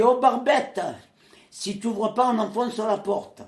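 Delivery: high, loud, rough threat through the door; blunt shout. Retold or imitated. Prisoner yelling at the general to open the door, loud and blunt. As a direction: bark it at the old general to open up. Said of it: "avec ma délicatesse habituelle".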